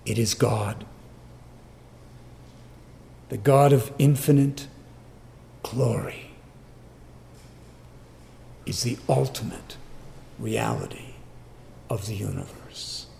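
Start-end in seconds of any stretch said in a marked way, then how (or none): 5.6-13.1 softer and softer, "reality" breathed without voice; sinking from soft speech into a breath; hushed. Real, not acted. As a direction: let it fall to a reverent near-whisper. Sermon phrase drops to hush.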